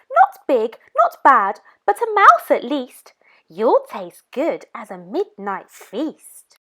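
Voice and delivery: a small monster eyeing her dinner; Gruffalo's child. Acted. Child voice menacing the mouse.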